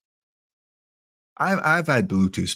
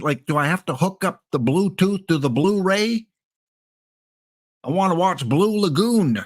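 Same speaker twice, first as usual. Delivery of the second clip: higher-pitched befuddled parent in a hammed-up American accent; put-on American accent; 5 semitones higher than usual. Over-fifty parent asking Johnny for tech help, put-on American accent. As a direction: play a clueless older American calling on the kid for help. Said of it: "I got an accent".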